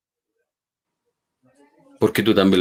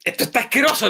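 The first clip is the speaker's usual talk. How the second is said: nervous blurt; 13 semitones higher, faster than usual. Retold or imitated. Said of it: "en un acto así muy nervioso".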